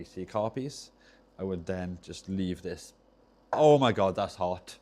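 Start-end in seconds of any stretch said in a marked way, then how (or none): 3.5-4.8 real shock at touching something hot; startled outburst. Real, not acted. Burns hand on hot iron.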